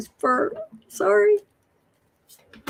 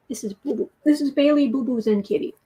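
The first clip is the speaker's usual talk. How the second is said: ordinary talk, nothing marked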